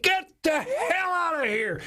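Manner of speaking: high, drawn-out mock yell; angry dad. Dad refuses to believe his son, angry mock yell. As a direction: send the kid away in an exaggerated holler.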